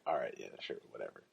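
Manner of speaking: low, soft brush-off; offhand agreement. Retold or imitated. Speaker to grateful guy wanting his number, offhand.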